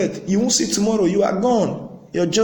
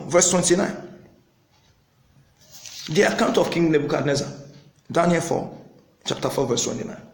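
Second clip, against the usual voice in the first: ordinary talk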